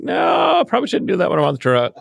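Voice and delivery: sheepish high-pitched no thanks; retold polite refusal. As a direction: brush off the party invite in a high, hurried voice. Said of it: "And I'm like".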